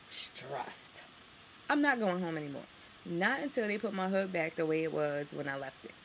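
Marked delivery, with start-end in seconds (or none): 0.1-1.0 breathless whisper. Real, not acted. Vlogger admitting stress to viewers, whispered. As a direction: let it fall out as a whisper.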